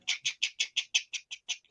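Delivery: very soft voiceless repeated clicks; imitated clicking noise. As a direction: tick out a quick, hushed run of clicks. Imitates noise heard behind him.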